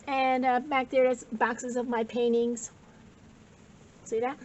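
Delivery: ordinary talk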